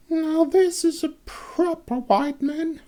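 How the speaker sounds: put-on high, slow old crone voice, murmured; approving old crone, murmured slowly; crone murmuring. A narrator's reading. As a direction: murmur it like a pleased old hag. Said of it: "she murmured".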